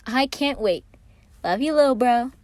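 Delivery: high, loud happy farewell; excited Patton. A narrator's reading. Patton saying goodbye to Virgil, high and excited. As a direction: bubble over with excitement.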